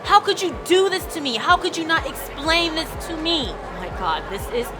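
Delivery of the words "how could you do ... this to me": panicked yell. Acted. [name] yells at Sid for dragging her into this. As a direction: let the panic burst out at her. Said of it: "I yell them out at her".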